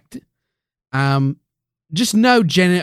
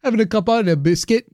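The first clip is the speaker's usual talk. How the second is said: mock UK accent; 8 semitones higher, faster than usual. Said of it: "our various UK accents".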